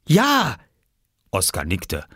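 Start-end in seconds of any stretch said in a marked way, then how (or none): 0.1-0.6 put-on higher, breathless child asking; a small boy begging excitedly; eager little boy. Oskar asking the farmer for a fish hook, eager.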